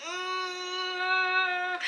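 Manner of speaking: long, high closed-mouth strain; held pushing grunt. Retold or imitated. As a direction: hum through a long imitated push. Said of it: "when you grunt so much".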